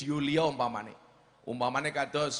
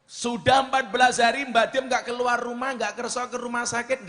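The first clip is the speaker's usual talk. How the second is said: urgent preaching; 8 semitones higher, 5 dB louder than usual. Acted. Worried family, preacher stresses.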